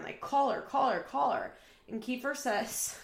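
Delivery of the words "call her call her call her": retold scream. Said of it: "Janelle's just screaming over and over again".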